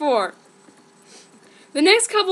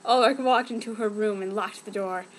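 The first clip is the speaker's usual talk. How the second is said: ordinary delivery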